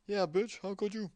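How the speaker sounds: mocking echo of the letter; high, catty jab; mock indignation.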